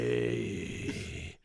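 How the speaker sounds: deep Keanu "Hey." dropped very low; lightly voiced Keanu dude. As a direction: drop into a slow stoner-dude hey. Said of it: "stayed in the dude voice".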